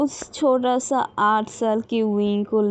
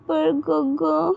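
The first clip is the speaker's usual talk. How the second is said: put-on high, small child's pleading voice; a lost little girl asking to be cared for; little girl's voice; 6 semitones higher, much slower than usual. A narrator's reading. Little Wing asks Gaga to stay, in a high childlike plea.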